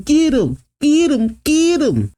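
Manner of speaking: very high chant without extra volume; restrained, high-pitched mock cheer; cheering crowd. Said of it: "the audience cheers".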